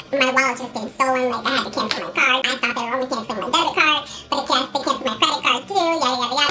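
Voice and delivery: high, rushed retelling of a panicked plea; fast, high-pitched mock-panicked rambling; mock frantic. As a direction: gabble the whole sob story at a high pitch. Retells her frantic explanation to cashier.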